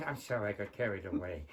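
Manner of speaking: low, soft, quick aside; sheepish apology. Acted. Comic apologizes for getting carried away, hushed and low. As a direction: drop right down after the outburst.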